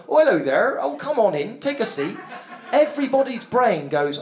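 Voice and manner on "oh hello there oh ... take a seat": put-on doctor with a Dorset accent. Dorset doctor calling a patient in, rural Dorset accent. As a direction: welcome a patient in comic Dorset tones. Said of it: "the doctor's like".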